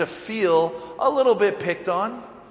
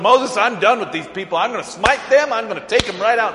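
fed-up God; 5 semitones higher, 5 dB louder, faster than usual. Retold or imitated.

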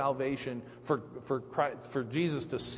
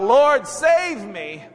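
loud, high, slow cry for rescue; sinking Peter shouting to Jesus for help, loud and slow; 10 semitones higher, 10 dB louder, slower than usual. Acted. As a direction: shout it as you go under. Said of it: "and cries out".

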